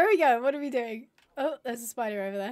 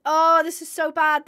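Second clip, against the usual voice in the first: ordinary delivery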